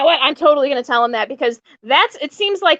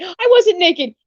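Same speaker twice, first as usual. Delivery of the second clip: mock indignant higher whine; whiny protest; 6 semitones higher, slower than usual. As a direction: whine it like a man insisting. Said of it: "he kept arguing that he wasn't naked".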